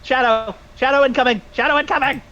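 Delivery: high squeaky bat voice, rising; little bat voice. Acted. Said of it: "in my little bat voice".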